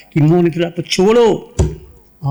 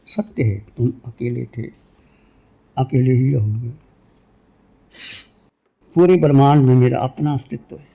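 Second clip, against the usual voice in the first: low, calm meditation voice with long pauses; guided meditation; 3 semitones lower than usual. Real, not acted. Teacher leading listeners into meditation, calm and low. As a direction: guide them inward in low, unhurried phrases.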